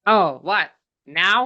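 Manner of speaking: lightly voiced deadpan with no inflection; flat monotone. Viewer mocking the character's lifeless delivery. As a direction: drain all the life out of it. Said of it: "zero personality and zero inflection".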